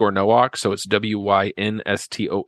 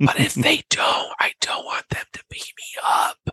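worried whisper to a friend; mock scared whisper; 4 semitones higher than usual. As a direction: whisper it half afraid, half joking. Retold fearful whisper.

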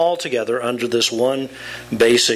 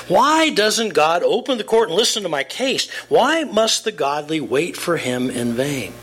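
raised, lamenting reading of Job; dramatic scripture reading; 6 semitones higher than usual. Acted. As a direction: read it as Job's own complaint. Reads Job's lament dramatically.

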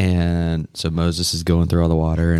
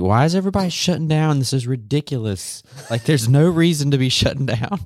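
indignant quote; 8 semitones higher than usual. Retold or imitated. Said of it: "I was like".

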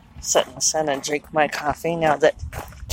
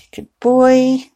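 pet praise voice; 6 semitones higher, 4 dB louder than usual. Real, not acted. Praising cat in harness.

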